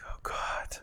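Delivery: barely voiced, sinking aside; mock dismayed whisper. Host losing the crowd to Lorna, dismayed whisper. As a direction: whisper it as your retold dread. Host despairing after the request.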